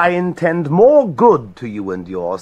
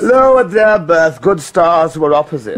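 higher, louder retort; raised retort; 4 semitones higher, 6 dB louder than usual. Acted. Richard snaps back at the grieving queen, loud. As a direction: snap the line back at her. Heated exchange with Elizabeth.

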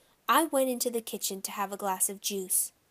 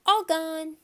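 put-on high, loud little-kid voice; small child's simple declaration; toddler announcing; 7 semitones higher, 6 dB louder than usual. A narrator's reading. Toddler announces lost teeth.

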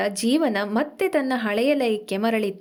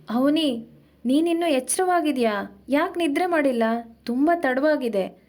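plain reading voice

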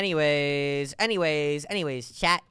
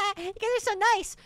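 high-pitched squeal; 13 semitones higher, faster than usual. Real, not acted.

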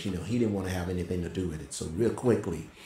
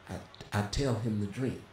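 ordinary delivery

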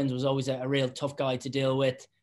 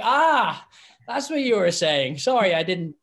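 higher, louder dawning 'ah'; realising surprise; 5 semitones higher, 6 dB louder, slower than usual. Retold or imitated. Referee finally understanding the captain, loud surprised realisation. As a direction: suddenly get what he meant. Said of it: "I was like".

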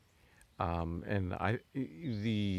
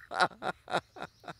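high breathy giggles; helpless giggling; giggling fit; 10 semitones higher than usual. Real, not acted. Can't stop laughing.

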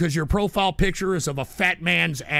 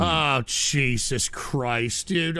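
low, slow, drawn-out groan of words; weary dismay; 8 semitones lower, much slower than usual. Real, not acted. Host reacting to a troll's joke, weary dismay. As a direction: groan it out in disbelief.

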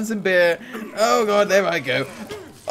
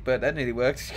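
usual speaking voice

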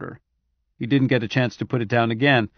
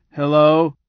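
toneless rasping cyborg hello; flat cyborg voice; 3 semitones higher, 7 dB louder than usual. Acted. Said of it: "Roger's flat cyborg voice rasping".

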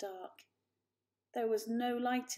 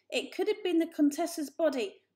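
higher and louder, urgent; urgent kitten; 8 semitones higher, 8 dB louder than usual. A narrator's reading. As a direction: blurt it out. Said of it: "he said".

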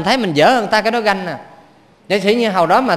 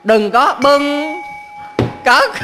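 slow drawn-out; 6 semitones higher, much slower than usual. Shown as a demonstration. Monk slowly spelling out the lesson, very slow. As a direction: stretch each word very slowly. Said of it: "quý vị nói với thầy".

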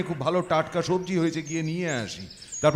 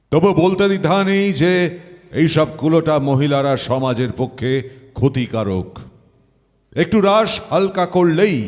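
loud, declamatory courtroom pronouncement; judge's verdict; 5 dB louder than usual. Acted. Judge condemning a woman to hang, loud solemn declamation.

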